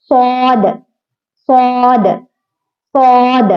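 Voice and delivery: सौद said thick and full-mouthed; teacher sounds सौद with a heavy s; heavy Saad. Shown as a demonstration. Teacher models heavy सौद for the class. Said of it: "एकदम मोटा पढ़ो".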